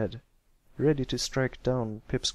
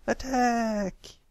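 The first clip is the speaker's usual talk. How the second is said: battle cry; 11 semitones higher than usual. A narrator's reading. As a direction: burst out of the barrel yelling. Young pirate bursts out.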